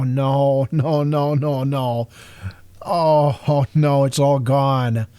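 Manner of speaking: real dismay, repeated no's sinking; slow, crushed realisation. Real, not acted. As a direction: watch your work vanish. Finds his yard deleted.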